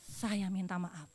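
slow, soft heartfelt sorry; quiet, slow apology; sincere apology. Real, not acted. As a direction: draw it out gently, meaning it.

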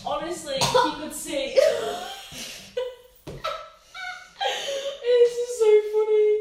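high giggling through the words; can barely talk for laughing. Real, not acted. Filming friend gagging, giggling.

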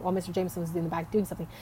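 ordinary talk, nothing marked

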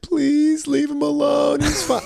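very high, drawn-out mock wail; imitated crying plea. Crying girlfriend pleading with police, high mock sobbing plea. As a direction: plead in a squeaky, tearful girlfriend impression. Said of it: "Now she's crying".

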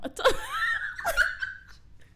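very high squealing laugh; burst of laughter. Real, not acted. Host cracks up at her own boredom remark, high squealing laugh.

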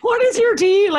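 loud, high mock demand; retold exasperated outburst. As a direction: push it up and out, fed up. Retold exasperated challenge, repeated harder.

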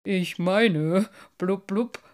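measured fish voice with bubbly blubs; ponderous, with popped blubs; deliberate carp. A narrator's reading. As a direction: weigh the words like an old carp. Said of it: "sagte der Karpfen bedächtig".

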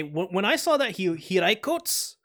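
normal talking voice

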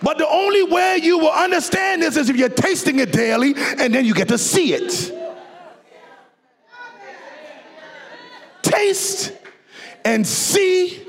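high, ringing preacher's cry, building to a call; soaring sermon climax with singing tones; full-force preaching. Acted. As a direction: preach it out to the whole church, voice lifted high.

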